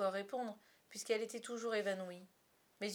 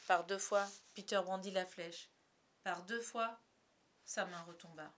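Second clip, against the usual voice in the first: usual speaking voice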